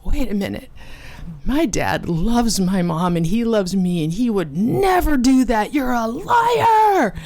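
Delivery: shouted denial. Retold or imitated. Child shouting at the woman in disbelief. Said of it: "I just shouted in her face".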